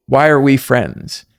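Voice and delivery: slow sincere question. Shown as a demonstration. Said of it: "get with somebody who knows you and ask".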